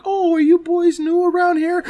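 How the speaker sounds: put-on very high, falsetto sweet old lady; high, sugary grandma act. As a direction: play a sugary little grandma. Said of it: "And she's like".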